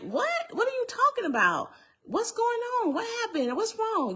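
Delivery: very high-pitched, rapid string of questions; retold alarmed questioning. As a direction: re-enact rising alarm, voice leaping way up. Retold alarmed questions to armed husband.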